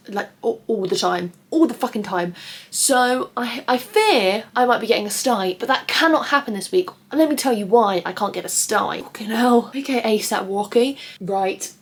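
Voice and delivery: ordinary talk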